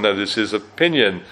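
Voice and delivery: ordinary talk, nothing marked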